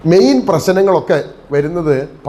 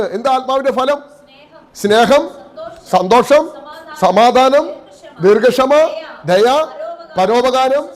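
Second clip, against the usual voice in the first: high-pitched, insistent sermon delivery; impassioned preaching; 6 semitones higher than usual. Real, not acted. Preacher urging the congregation to sow in the spirit, impassioned preaching. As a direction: preach it high, ringing out each item.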